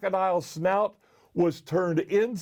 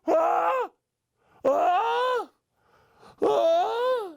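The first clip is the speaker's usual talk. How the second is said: high, rising "ah" before a sneeze; acted pre-sneeze inhalations, each held; sneeze build-up gasps; 12 semitones higher, much slower than usual. A narrator's reading. Elephant winding up to sneeze.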